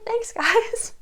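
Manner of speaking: lightly voiced grateful woman; high mock voice. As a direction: chirp a thankful "thanks, guys" up high. Mrs Nolan voiced in recap.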